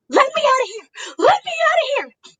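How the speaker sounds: high wailing plea, hammed up; mock hysterical screaming. Fan imitates Bianca trapped in the pod, hysterical screaming. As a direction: imitate frantic screams to be let out. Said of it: "Screaming, hysterical".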